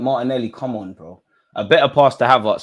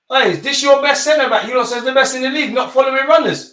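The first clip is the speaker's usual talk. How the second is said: much higher sarcastic taunt; mocking jeer; 14 semitones higher than usual. Real, not acted. Fan taunting the rival centre back, high mocking jeer.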